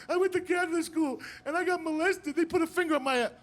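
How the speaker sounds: high-pitched whiny mock sob; mock crying. Comic mimicking people crying on TV, high mock crying. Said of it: "people on there crying".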